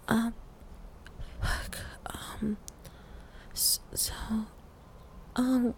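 very soft, halting, breathy fragments; faint gasped murmurs; overwhelmed whisper. Acted. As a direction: barely get the words out between breaths. Overwhelmed, barely able to speak.

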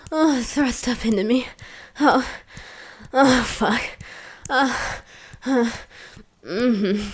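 loud, rhythmic moans; moaning. Acted. Moaning during acted intimacy.

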